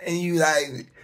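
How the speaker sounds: ordinary delivery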